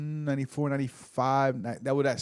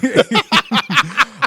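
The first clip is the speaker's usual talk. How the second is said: laugh; 10 semitones higher, 9 dB louder than usual. Real, not acted. Laughs at joke.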